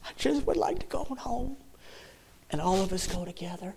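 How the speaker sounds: high, soft, thin with real emotion; choked longing. Real, not acted. Preacher tells congregation he'd like to go home, choked longing. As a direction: voice lifts high and small, close to tears.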